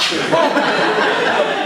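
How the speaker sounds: loud high burst of laughter. Real, not acted. Laughing after explosion.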